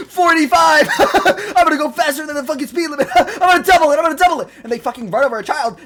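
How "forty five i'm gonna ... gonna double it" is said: high, loud maniacal cackling voice; mock reckless speeder. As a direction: crow gleefully between bursts of laughter. Mocking reckless school zone speeders.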